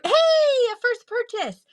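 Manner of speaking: high, slow delighted exclamation; excited. Real, not acted. Host greeting her first sale of the stream, delighted. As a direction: savour the news of a first sale.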